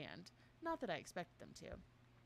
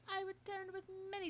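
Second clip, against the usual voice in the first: higher voice; 11 semitones higher than usual. Acted. Slipping into a higher voice.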